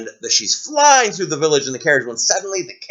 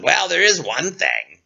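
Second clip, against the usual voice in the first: frog voice. Acted. Frog speaks.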